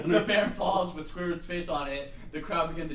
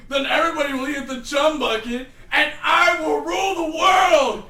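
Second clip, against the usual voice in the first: laughing, shouting evil villain; megalomaniac rant; 6 semitones higher, 8 dB louder than usual. Acted. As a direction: gloat over the evil plan loudly. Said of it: "I got too into it".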